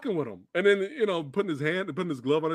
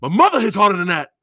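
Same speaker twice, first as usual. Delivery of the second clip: high, loud, fast taunt; hammed-up fighter's taunt at his opponent; mock taunt; 4 semitones higher, 8 dB louder than usual. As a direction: spit it back cockily. Rocky taunting Clubber back.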